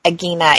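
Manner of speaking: "Agina" said uh-GHEE-nuh, hard g; hard-g pronunciation. Shown as a demonstration. Trying a third guess at the surname, "Agina" as uh-GHEE-nuh. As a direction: say "Agina" with g as in geese, then EE. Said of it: "It could be even Agina".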